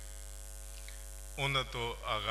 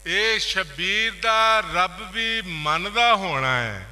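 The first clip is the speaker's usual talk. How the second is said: measured opening, then higher, ringing recital; poetry recitation; 3 semitones higher, 7 dB louder than usual. Acted. Poet reciting a couplet on Shabbir, declaimed. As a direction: build from a first reading to full declamation.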